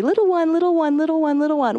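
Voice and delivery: put-on high, repeated baby-talk call; retold mothering singsong; doting mom. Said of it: "I would call Ethan little one".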